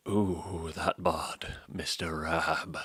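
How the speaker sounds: whispered, slow mock flirt; hammed-up flirty whisper; mock leering. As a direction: purr it like you're ogling him. Leering over Raab's looks.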